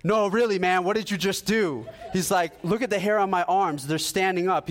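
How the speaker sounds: imitating a man begging to know what happened; mock amazed friend; excited insistence.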